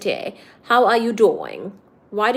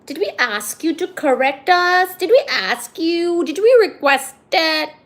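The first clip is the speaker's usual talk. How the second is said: mock indignation; 6 semitones higher, 5 dB louder than usual. Mock telling-off of a correcting viewer.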